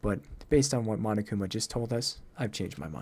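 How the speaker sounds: low, restrained deductive voice; stern, aloof game character. Acted. Low figure voice in game dialogue.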